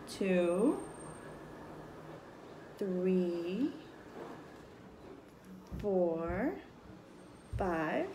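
put-on slow, drawn-out counting for children; teaching a child to count. Shown as a demonstration. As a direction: count each number slowly. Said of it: "I'm gonna make ten dots".

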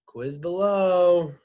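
slow, loud, stretched call; high, lingering announcer-like call; drawn-out announcement. Real, not acted. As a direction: draw out each word as a playful sign-off. Drawn-out sign-off call to quiz.

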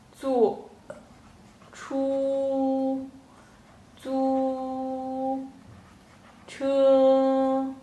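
坐, 出, 租, 车 each separate, the last three held long and level; syllable by syllable. Shown as a demonstration. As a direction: stretch each syllable out for learners. Teacher models taxi phrase syllable by syllable.

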